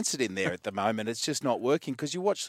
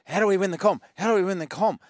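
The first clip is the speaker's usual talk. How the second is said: imitated fretting; 8 semitones higher, 4 dB louder, faster than usual. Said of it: "instead of every week going".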